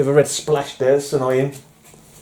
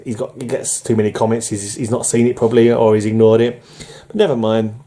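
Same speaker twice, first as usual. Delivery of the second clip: ordinary talk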